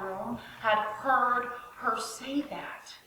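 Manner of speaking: slow hushed whisper; slow conspiratorial whisper; whispered aside. Real, not acted. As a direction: lean in and whisper it. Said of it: "whispered aside".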